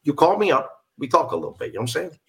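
streetwise New York buddy talk; New York accent. Shown as a demonstration. Actor telling a pal to call him, New York accent. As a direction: slip into chummy New York. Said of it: "I do also do the New York accent".

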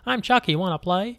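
high, bright toy doll voice; chirpy pull-string doll voice; talking doll voice. Retold or imitated. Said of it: "like the automated voice".

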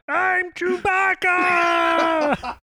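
very high, drawn-out shouted growl; Chewbacca roar. Retold or imitated. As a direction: howl it like a Wookiee. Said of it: "my Chewbacca".

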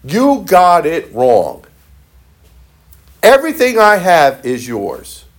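very slow, gentle correction; father's slow reassurance to his son; tender father. Retold or imitated. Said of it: "what did the father say".

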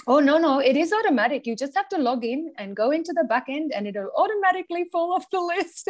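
put-on quoting someone in a high Indian-accented voice. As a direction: imitate a reassuring developer with an Indian accent. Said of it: "with a gorgeous Indian accent said".

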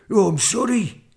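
high, loud flustered dragon; spluttering dragon. A narrator's reading. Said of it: "he spluttered".

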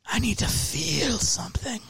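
mock angsty whisper. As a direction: breathe it out like a sad teen. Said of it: "that is the music I put on when I'm like".

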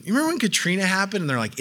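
ordinary talk, nothing marked

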